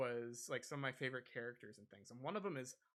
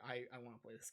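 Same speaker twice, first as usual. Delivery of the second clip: normal talking voice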